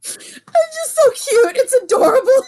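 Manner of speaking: high, squeally gushing through laughter; laughing delight. Real, not acted. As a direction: gush while cracking up. Cracking up at friend's attempt.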